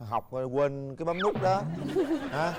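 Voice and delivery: slow, teasing line breaking into a laugh; real laughter creeping into the words; amused teasing. Real, not acted. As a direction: tease gently, letting a chuckle out at the end. Host teases about the forgotten button.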